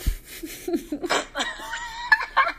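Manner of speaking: high, long burst of laughter; big laugh. Real, not acted. Joking about goals for Rob.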